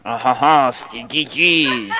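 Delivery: put-on slow, laughing French accent. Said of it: "really heavy French accent".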